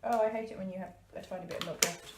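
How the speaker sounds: ordinary talk, nothing marked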